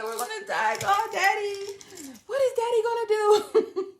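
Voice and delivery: high, laughing frantic cries; frantic panic. Retold or imitated. Begging sister to call Daddy from the ocean, high frantic cries. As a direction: spiral into panic, laughing through it. Panic on jet ski retold.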